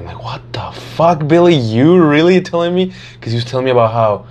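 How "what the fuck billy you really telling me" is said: slow, high incredulous outburst; disbelief. Retold or imitated. Hearing Billy wants to go out, slow stunned disbelief.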